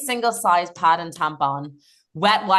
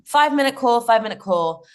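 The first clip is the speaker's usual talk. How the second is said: 1 calling out; 6 semitones higher, 4 dB louder than usual. Retold or imitated. Backstage call retold.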